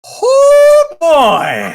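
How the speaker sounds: high, loud drawn-out exclamation; loud shock at a bold move; real astonished cry. Real, not acted. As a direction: shriek it, then drop in pitch. Reacts to crown being snatched.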